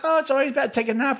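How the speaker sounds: high, fast imitation of his wife; mock wife voice. His wife brushing off his excuse, high and quick. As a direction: chirp it quickly like a cheerful wife.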